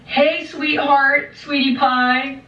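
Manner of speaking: high, drawn-out mock endearments; mock sweet-talk. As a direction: coo it slowly, imitating the officer. Officer sweet-talking her in a retelling.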